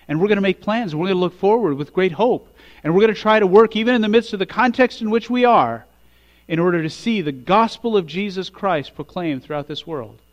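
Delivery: full-force preaching. Acted.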